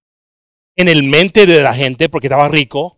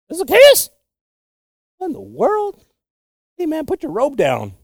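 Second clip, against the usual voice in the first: much higher, sneering crowd voice; mocking onlooker; 10 semitones higher than usual. Crowd jeering at Zacchaeus climbing the tree, high mocking voice. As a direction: play a bystander scoffing at the little man in the tree. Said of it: "People are probably seeing him like".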